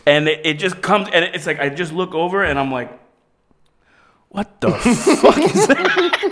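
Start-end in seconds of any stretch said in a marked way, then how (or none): 4.3-6.3 high, drawn-out question breaking into laughter; laughing disbelief. Retold or imitated. Friend spotting the mess on the shoe, laughing disbelief. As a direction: crack up midway through a stunned retold reaction. Retells shocked discovery, cracking up.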